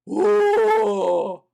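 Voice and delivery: high, loud ghostly wail; hammed-up haunting ooh; spooky ghost ooh. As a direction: moan it like a cartoon ghost. Ghost taunting, spooky noise.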